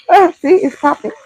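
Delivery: high startled yelp. Real, not acted. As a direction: jump at the popping oil. Bacon spattering while cooking.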